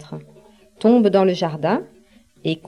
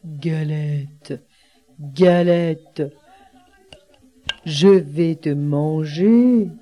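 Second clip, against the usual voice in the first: low, slow storybook bear; big growling bear; 5 semitones lower, much slower than usual. A narrator's reading. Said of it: "grogne l'ours de sa grosse voix".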